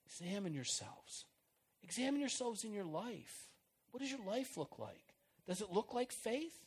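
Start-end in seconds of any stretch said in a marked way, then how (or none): none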